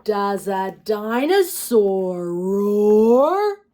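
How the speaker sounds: slow, loud, drawn-out roar; mock roar. A narrator's reading. Reading to kids, playful dinosaur roar.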